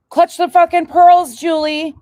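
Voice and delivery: gasping, high and loud; clutch-the-pearls outrage; mock shock. Mock gasp at co-host.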